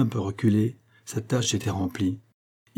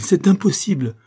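loud, high protest of disbelief; crying out; 9 semitones higher, 6 dB louder than usual. A narrator's reading. Aide refusing the minister's news, crying out. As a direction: burst out, pitch jumping up. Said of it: "s'écria-t-il".

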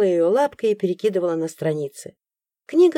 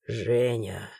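low, soft, doomed sigh of her name; resigned sigh; 8 semitones lower, 6 dB quieter than usual. A narrator's reading. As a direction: sigh it out like a man giving up. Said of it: "обречённо вздохнул Крис".

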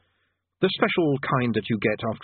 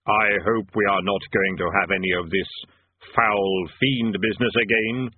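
grim reaper voice; 3 semitones lower than usual. A narrator's reading. Death speaks.

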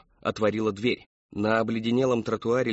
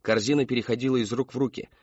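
usual speaking voice

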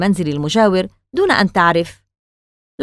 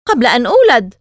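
a sharp, louder snap back; sharp retort; 4 semitones higher, 5 dB louder than usual. A narrator's reading. Hana answering the boy sharply about her yard, louder snap. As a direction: cut him off with a pointed reply. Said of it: "قالت بحدة".

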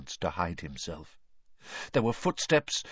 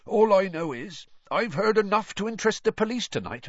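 high, forceful accusation; bold, steadier; 10 semitones higher, 4 dB louder, faster than usual. A narrator's reading. Intruder threatens master with the police, boldly. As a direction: stand up to him, pitched high. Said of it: "his voice growing steadier".

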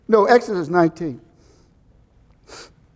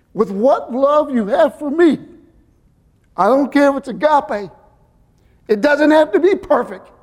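tender Jesus voice; 5 semitones higher, 3 dB louder than usual. Acted. Jesus reassuring the preacher, tender and higher. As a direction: speak as a loving Lord reassuring his servant. Voicing Jesus speaking to him.

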